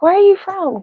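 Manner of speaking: high-pitched imitation of curious people; mock puzzled question. Streamer imitating people who can't place her, high mock question. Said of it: "people are always like".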